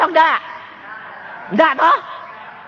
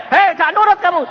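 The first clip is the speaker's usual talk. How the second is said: louder, higher scolding bark; sharp, raised-voice telling-off; mock scolding; 8 semitones higher, 5 dB louder than usual. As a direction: tell off a disobedient patient, playfully. Acts a doctor scolding patient.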